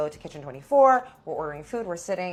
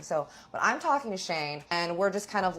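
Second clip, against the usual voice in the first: ordinary talk, nothing marked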